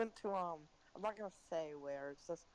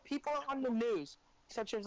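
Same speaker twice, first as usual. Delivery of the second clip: usual speaking voice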